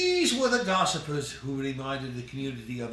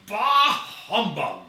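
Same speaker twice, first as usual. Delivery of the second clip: louder, higher mock Scrooge grumble; bah humbug; 5 semitones higher, 7 dB louder than usual. The narrator slips into old Scrooge, scoffing loudly. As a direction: spit out the old miser's scoff.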